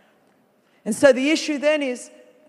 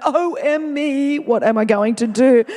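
slow, louder overdone wail of worry; mock fretting God; 6 dB louder, slower than usual. Preacher mocks a God who frets in heaven, slow hammed-up wail. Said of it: "Do you think God's in heaven going".

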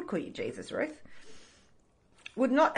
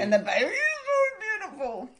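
high gushing "so beautiful"; 11 semitones higher, 3 dB louder than usual. Real, not acted. Gushing over friend's new baby.

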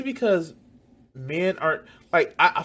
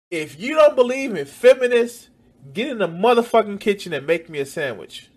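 mock shout; 7 semitones higher, 8 dB louder than usual. Mocking anti-feminist men, shouting.

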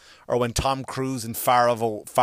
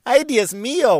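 hammed-up swooning woman; 10 semitones higher, 4 dB louder than usual. Mimics clichéd background women.

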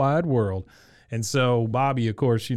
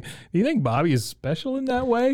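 high mock mom voice, pitched way up; imitating Peggy; 11 semitones higher than usual. Mother asks the clerk about her son, in a high mock voice. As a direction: tease it like a prim, worried mother. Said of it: "Peggy's like".

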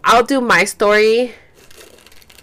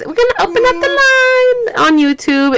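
sing-song announcement; 3 dB louder than usual. Real, not acted. Host announcing to her viewers, high sing-song. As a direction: lift it up like a jingle.

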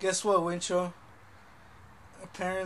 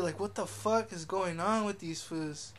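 ordinary talk

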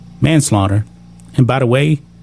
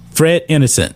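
ordinary talk

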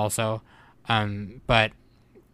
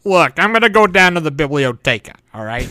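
louder mock gringo saying biblioteca; heavy English accent; 6 semitones higher, 10 dB louder than usual. Joking with co-hosts, mock gringo English accent. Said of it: "super huge English accent".

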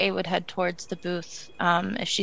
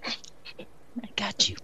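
slow whisper, reassuring; 9 dB quieter, much slower than usual. Real, not acted. Player quietly reassuring Hope she will pay, whispered. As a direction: lean in and whisper it. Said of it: "whispered reassurance to friend".

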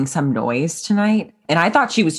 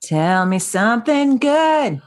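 drawn-out prompt; 8 semitones higher, much slower than usual. Real, not acted. Host prompting cohost, drawn out.

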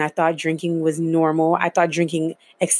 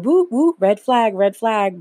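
mock alarm; 5 semitones higher, 3 dB louder, slower than usual. Inner alarm about the date.

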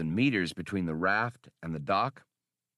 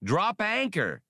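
shouted; 10 semitones higher than usual. A narrator's reading. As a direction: yell the command. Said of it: "shouted Han".

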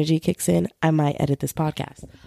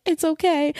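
soothing roommate; 10 semitones higher than usual. Retold or imitated. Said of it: "the Cancer roommate was like".

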